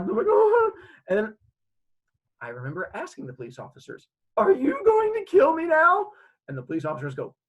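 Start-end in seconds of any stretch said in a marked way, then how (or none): 0.3-0.7 loud, high bawling cry; child's wail. Retold or imitated. Child cries out to police. 4.4-6.1 loud, high wailing question; panicked child. Retold or imitated. Terrified child asks police.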